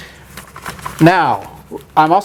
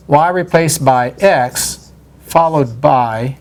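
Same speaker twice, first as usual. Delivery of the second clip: very slow, deliberate reading; dictating; 2 semitones lower, much slower than usual. Shown as a demonstration. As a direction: spell out the notation slowly. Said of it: "then can you tell me".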